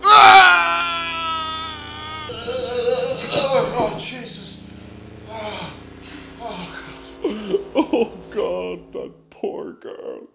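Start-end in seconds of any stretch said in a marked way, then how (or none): 0.0-2.3 loud high pained wail; anguished cry. Acted. Man cries out at his crying niece. 8.9-10.3 hushed, slow whisper of pity. Acted. Uncle whispers sorrow for his niece.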